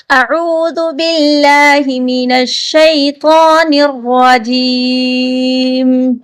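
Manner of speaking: slow melodic recitation; Quranic recitation. Acted. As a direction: draw out each word in chanted recitation. Recites taawwuz and basmala.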